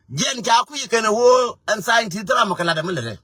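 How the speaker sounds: high, loud call to the crowd; shouting. Real, not acted. Preacher shouting the point out to the listeners. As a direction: belt it out over the room.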